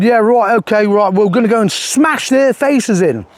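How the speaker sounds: high-pitched mock London geezer; Tommy's fake London accent. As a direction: imitate a put-on London tough talker. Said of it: "And so Tommy said".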